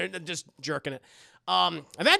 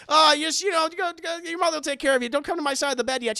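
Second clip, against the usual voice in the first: fast, higher shoo-away; hurried brush-off; 9 semitones higher, 6 dB louder, faster than usual. Retold or imitated. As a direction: rush them off. Dad's hurried aside.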